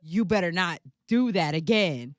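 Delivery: loud, emphatic mock telling-off; retold scolding. Baby telling off his mother, loud retold scolding. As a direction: retell the kid's warning with punched volume. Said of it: "Screaming at her".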